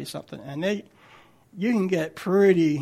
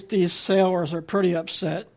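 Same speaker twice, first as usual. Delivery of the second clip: ordinary delivery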